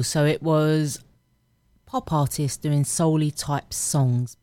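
ordinary delivery

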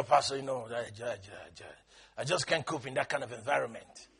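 very low, soft mock mumble; excuse-making church member. Church member telling pastor they can't cope, low soft mock excuse.

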